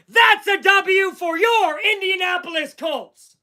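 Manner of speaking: high, slow, shouted sign-off of the win; victory call. Real, not acted. Fan announcing the Colts win to viewers, high and shouted. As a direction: bellow the result out.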